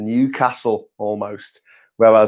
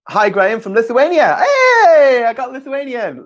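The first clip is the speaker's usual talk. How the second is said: high, loud hey; bright, loud; excited greeting; 12 semitones higher, 4 dB louder than usual. Real, not acted. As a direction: greet him with a happy shout.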